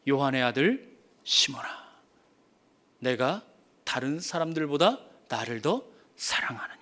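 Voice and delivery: low, soft, ending in a whisper; tender question. Acted. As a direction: ask gently, almost whispering. Jesus quietly asks Peter. (낮고 부드럽게, 끝은 속삭이듯)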